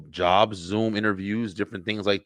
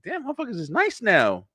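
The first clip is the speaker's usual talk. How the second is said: impressed surprise; 11 semitones higher than usual. Retold or imitated.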